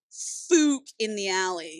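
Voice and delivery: slow, drawn-out fook; comic drawl. Pretend. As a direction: stretch it out for laughs. Comic punchline, slowed.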